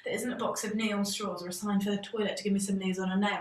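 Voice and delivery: ordinary talk, nothing marked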